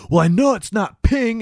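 high, put-on character voice; high quoted voice. Friend quoting the guy about the ghost, high voice. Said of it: "And he goes".

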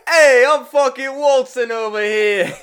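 hammed-up New York guy; New York accent. Host echoing the New York accent, loud. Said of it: "New York accent".

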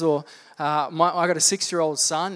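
normal talking voice

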